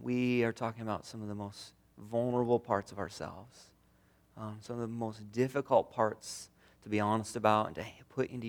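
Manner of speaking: soft, low, unhurried prayer voice; quiet prayer. Acted. Pastor prays softly over the grieving congregation. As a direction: pray gently, drawing the room inward.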